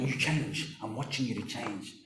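very soft, low murmur; hushed expectant watching. Acted. Preacher acting someone expecting another to change, hushed. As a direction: quietly keep watch over them.

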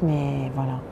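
ordinary delivery

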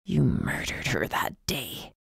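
low, quiet, harsh accusation. Acted. Stranger accusing Megan of murder, low harsh voice. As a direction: accuse her low and hard. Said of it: "he said harshly".

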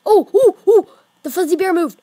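high, loud panicked cry; startled shriek. Real, not acted. Gamer yelping when the bear moves.

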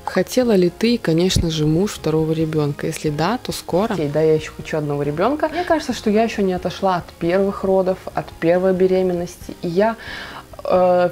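ordinary delivery

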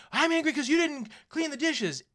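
high, loud mock scolding; imitating angry mom. Mom scolding her kid over the dishes, mock yell. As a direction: play the fed-up mom yelling. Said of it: "Your mom's screaming at you".

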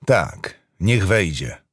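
ordinary delivery, nothing marked

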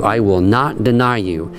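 ordinary talk

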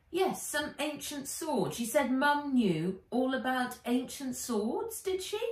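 put-on high girl voice, puzzled question at the end; girl Nat questioning. A narrator's reading. Nat asks her guardian about Mum: high, puzzled girl voice.

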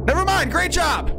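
squeaky high sarcasm; high sarcastic squeak. Real, not acted. Sarcastic after a scare.

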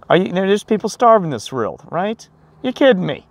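can't believe people waste like this; indignant disbelief. Real, not acted. Vlogger ranting at wasteful strangers, high indignant disbelief.

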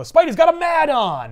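high, loud battle cry; mock heroic shout. Spider-Man crashing through a window, mock heroic shout. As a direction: bellow it crashing in. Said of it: "crash the window and go".

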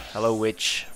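ordinary talk, nothing marked

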